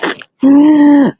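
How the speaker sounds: high, loud burst of laughter; real cracking up. Real, not acted. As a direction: crack up helplessly at your own story. Cracks up after imitating the siren.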